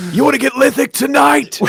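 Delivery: high, loud party-bro pitch; hyped rocker voice. Retold or imitated. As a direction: sell the band name like a hype man. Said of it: "So I'd be like".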